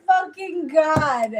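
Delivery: high, slow stunned disbelief; drawn out in shock. Retold or imitated. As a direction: kid stunned by the aunt's advice, high and slow. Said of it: "I was like".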